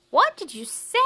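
high, very loud question; cricket shouting over music. Acted. Cricket can't hear the mouse, hollering question. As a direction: holler it as if deafened.

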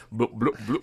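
low bubbling pipe noise; shouting underwater. Retold or imitated. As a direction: gurgle like a bong.

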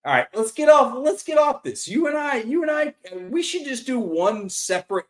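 high, loud interrupting. Real, not acted. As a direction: raise the voice to change topic. Cutting off the topic loudly.

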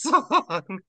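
ordinary delivery

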